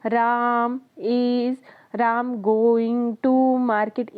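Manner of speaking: very slow, with long gaps between the words; broken, gappy reading. Shown as a demonstration. Teacher showing students how a badly gapped sentence sounds, very slow. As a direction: stumble through it with pauses everywhere. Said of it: "इतना लंबा अगर हम कोई sentence बोलेंगे gap ले लेके".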